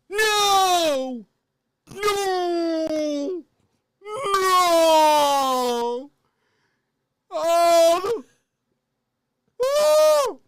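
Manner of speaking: high, loud mock wailing cries; hammed-up anguished wail. Acting the spilled meatballs scene, hammed-up wailing. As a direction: howl in fake despair over the spill.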